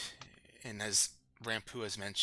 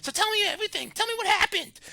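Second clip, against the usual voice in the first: much higher, louder squeaky kobold chatter; excited kobold voice; 8 dB louder than usual. Acted. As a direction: chirp it out like an eager little kobold. Kobolds cheering the returned hero.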